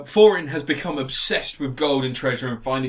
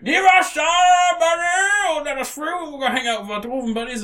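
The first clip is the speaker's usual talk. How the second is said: hammed-up roaring dwarf voice; Billy Connolly as dwarf; 11 semitones higher, 3 dB louder than usual. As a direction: bellow it high, then ease into a quick threat. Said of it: "Billy Connolly comes and he's a, he's a dwarf".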